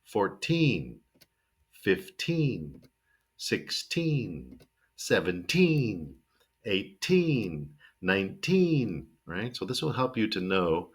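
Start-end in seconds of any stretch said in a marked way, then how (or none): 0.0-9.1 put-on each of "fourteen" to "nineteen" with a long TEEN; stress on TEEN. Shown as a demonstration. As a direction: slowly stretch the TEEN in "fourteen" through "nineteen". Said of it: "we wanna stress the teen".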